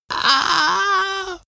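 high piercing scream of pain. A narrator's reading. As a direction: scream as the jaws close. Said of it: "he screamed".

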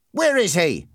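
sharp, mildly angry challenge; angry demand. A narrator's reading. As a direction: demand it like a trigger-happy bully. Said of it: "Ernie asked angrily".

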